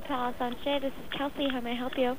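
normal talking voice

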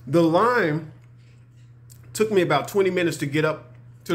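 chewing between words; talking while chewing. Real, not acted. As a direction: mouth partly full. Seen 2: Bites food at -5; lips pressed, jaw working through early frames.

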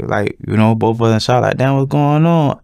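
ordinary delivery

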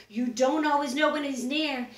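plain reading voice

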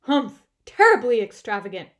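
a little loud. A narrator's reading. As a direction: huff loudly in disapproval. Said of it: "Bear's voice was a little loud".

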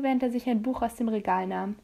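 ordinary delivery

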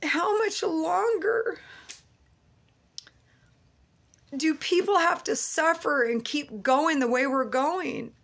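high, tearful plea; crying out to the Lord, voice breaking. Real, not acted. Weeping as she asks God how long.

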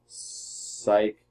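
breathy unvoiced "Psych."; a sly whispered "Psych.". Real, not acted. Choosing the psych move again.

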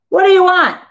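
loud, high demand; factory boss yelling at the boy. A narrator's reading. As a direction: bark it at the boy. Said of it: "the boss yells at me".

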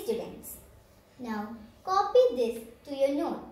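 ordinary delivery

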